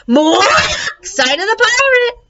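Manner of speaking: high slow squawking shout; parrot shriek. Acted. Parrot squawks the clue at Judy and Stink, high.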